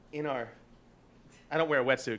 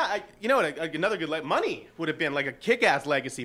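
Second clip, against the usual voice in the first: ordinary talk, nothing marked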